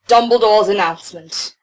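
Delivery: ordinary talk, nothing marked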